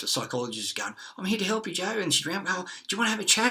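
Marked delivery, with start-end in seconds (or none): 1.2-2.0 sugary mock helper voice, pitched higher; mock sweet psychologist. Mimicking the Penrith psychologist offering help, sugary and high. 2.5-3.5 high, fast, sing-song fake concern; mock sweet psychologist. Mimicking the psychologist asking him to chat, high and quick.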